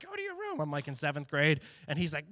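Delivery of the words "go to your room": squeaky mock order.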